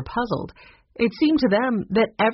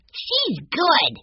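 put-on much higher, piping child voice; little boy; 10 semitones higher than usual. A narrator's reading. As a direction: pipe up as an eager small boy. Young Benny pipes up.

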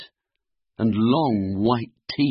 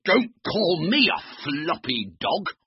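higher-pitched, indignant retort; cross; 6 semitones higher than usual. Acted. Floppy answers the big dog's taunt, cross. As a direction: object with mild crossness, pitched up. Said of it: "Floppy was cross".

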